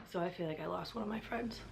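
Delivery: very soft, nearly voiceless; choked up. Real, not acted. Mourning an actor from her comfort show, choked up. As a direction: barely get it out through real sadness. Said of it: "I'm getting choked up".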